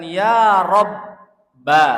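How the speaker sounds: slow, loud, rising call "Ya Robba"; calling out to God. Shown as a demonstration. Preacher showing how one calls upon God, loud and drawn out. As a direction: cry out to the Lord, stretching each word. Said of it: "Seolah-olah kita mengatakan".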